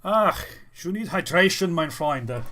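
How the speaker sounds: hammed-up German exclamation; German accent. Friend urges hydration, in a mock German accent. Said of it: "in a German accent".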